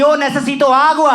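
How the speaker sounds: slow, loud, laughing mock Spanish; broken Spanish. Comedian out of English words switching to Spanish, loud and slow. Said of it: "मैं Spanish में लग गया".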